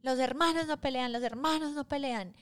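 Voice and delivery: high mock yell, repeated; retold yelling. Dad shouting the rule while beating his kids, in a high mock yell. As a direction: chant the rule like an angry dad. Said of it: "Solamente gritaba eso".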